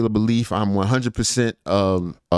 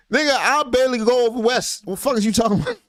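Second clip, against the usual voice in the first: high, put-on indignant voice; mock outrage; 13 semitones higher than usual. Streamer mocking critics in a high, indignant voice. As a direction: play it up as fake fury.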